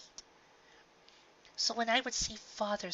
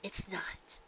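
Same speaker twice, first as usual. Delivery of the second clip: soft whisper; 2 semitones lower, 5 dB quieter than usual. Acted. Mare's gentle reply.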